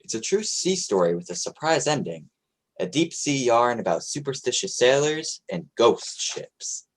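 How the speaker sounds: ordinary talk, nothing marked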